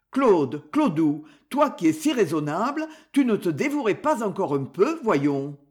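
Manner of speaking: ordinary delivery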